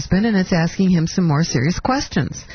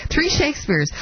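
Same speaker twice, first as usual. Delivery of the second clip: pitched higher in mocking disbelief at "Three Shakespeares"; incredulous echo; 6 semitones higher than usual. Host mocking the president's odd phrase, incredulous and pitched higher. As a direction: repeat "Three Shakespeares" higher, as if you can't believe it. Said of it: "Who talks like that?".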